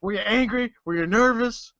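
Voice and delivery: gruff interviewer voice; Stone Cold interviewer. Retold or imitated. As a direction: ask like a Texan podcast host. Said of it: "Talking to Tommy".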